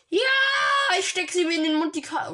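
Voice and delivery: loud, high excited yell; excited shout. Real, not acted. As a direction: shout it out in the heat of play. Yells while grabbing the card.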